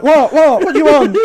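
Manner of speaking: high, loud yelled what at a distance; shouted reply. Retold or imitated. Son yells back when mum shouts Keith from the kitchen.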